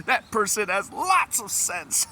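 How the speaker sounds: high, giggly delivery; laughing while talking. Real, not acted. Translating the phrase while cracking up. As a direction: talk through real laughter.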